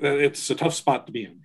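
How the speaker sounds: ordinary delivery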